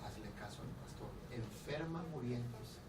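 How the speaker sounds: sick daughter weakly begging her mother; weak sick girl. Retold or imitated. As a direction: barely get the words out, like a dying daughter. Dying daughter pleads with mother.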